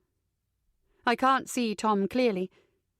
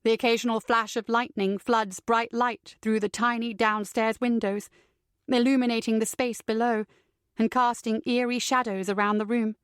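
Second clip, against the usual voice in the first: usual speaking voice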